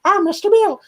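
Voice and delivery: very high squeaky falsetto; silly high-pitched character impression; Mister Bill. Shown as a demonstration. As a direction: put on a tiny high cartoon voice. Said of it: "you gotta do the voice".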